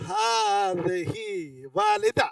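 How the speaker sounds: very slow, drawn-out ھذہ; slow recitation. Shown as a demonstration. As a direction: stretch each word out for the class. Teacher models phrase for class quiz. (ٹھہر ٹھہر کر پڑھنا)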